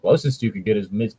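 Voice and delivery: normal talking voice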